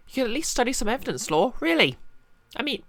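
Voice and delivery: high, scolding and exasperated; exasperated young woman voice. Acted. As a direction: nag him in a high exasperated voice. Exasperated character voice.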